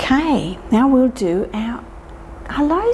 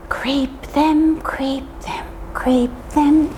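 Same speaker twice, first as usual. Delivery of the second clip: a sneaky whisper for the creeping fingers; 5 semitones higher, slower than usual. Acted.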